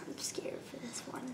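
scared whisper. Real, not acted. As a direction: breathe it out, afraid. Nervous before sour candy.

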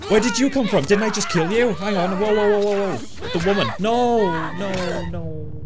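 high, loud startled protest; alarmed shouting. Real, not acted. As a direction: yell in panic at a surprise attacker. Ambushed by enemy in game.

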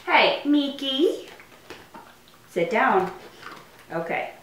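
ordinary talk

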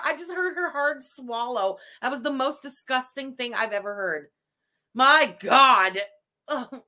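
ordinary delivery

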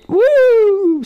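high, loud cheer; celebratory whoop. Real, not acted. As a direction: let out a victory whoop. Celebrates that the enemies are dead.

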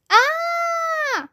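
high shrill shout of fright; startled scream. Acted. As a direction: yelp in sudden terror. Boy wakes to three ghosts.

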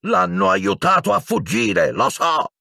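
very high hysterical wail; furious howl. A narrator's reading. Professor accusing the pair of aiding escape, howling. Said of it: "ululò Piton".

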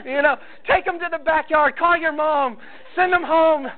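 high, hurried orders in a panic; urgent panicked orders. Retold or imitated. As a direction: rush the husband to get the kids away. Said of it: "she's like".

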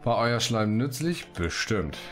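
usual speaking voice

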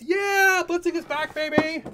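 high, loud whooping celebration; hyped cheer. Pretend. Cheering the return of blitzing, high hyped yell. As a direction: shout it way up high like a fan.

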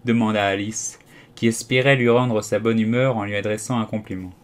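usual speaking voice

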